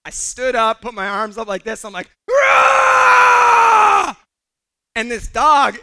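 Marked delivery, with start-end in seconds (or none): 2.3-4.2 long, loud, high scream-roar; hammed-up shrieked child's roar; high-pitched kid roar. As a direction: roar like a small kid scaring off a dog. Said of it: "high-pitched voice kind of way".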